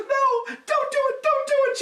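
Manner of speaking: put-on very high pleading girlfriend; high pleading Betty. A narrator's reading. Betty pleading from the tree, very high. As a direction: beg him not to, up high. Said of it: "She's all sad".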